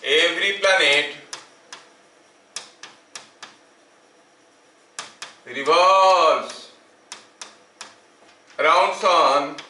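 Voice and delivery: drawn-out words with long gaps; slow, stretched classroom dictation. Real, not acted. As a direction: dictate word by word, stretching each one. Dictating the law while writing.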